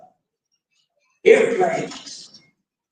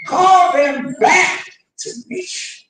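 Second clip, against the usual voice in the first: loud, high, slow rallying call; preaching climax; 6 semitones higher, 6 dB louder, slower than usual. Acted. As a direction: thunder it to the congregation.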